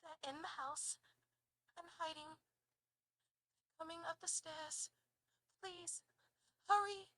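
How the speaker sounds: put-on British accent. Caller hiding from an intruder, British accent. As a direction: act frightened in a British accent. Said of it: "do it in a British accent".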